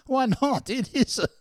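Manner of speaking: high, innocent protest; puzzled Dick. Retold or imitated. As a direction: raise the pitch, baffled. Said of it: "Dick answering".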